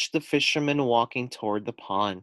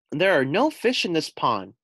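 put-on higher, louder child shout; 6 semitones higher, 4 dB louder than usual. Little possum yells at the fisherman, louder. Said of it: "the little possum shouted".

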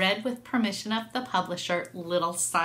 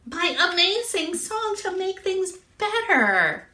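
high, excited cat exclamation; delighted cat; 10 semitones higher, 4 dB louder than usual. A narrator's reading. Cat hears friends singing her song, delighted. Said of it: "Cat said".

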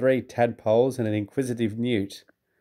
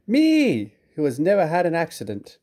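indignant huff; 6 semitones higher than usual. A narrator's reading. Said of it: "letting off steam".

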